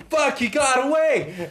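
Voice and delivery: high, loud hammed-up frantic cry; Michelle Rodriguez. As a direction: yell it like a panicked action heroine. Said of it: "Michelle Rodriguez is like".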